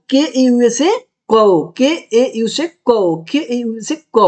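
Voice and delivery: के ए यू से कौ spoken slowly, letter by letter, for pupils to copy; slow spelling drill. Shown as a demonstration. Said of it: "ऐसे पढ़ेंगे". (धीरे-धीरे spelling बोलते हुए)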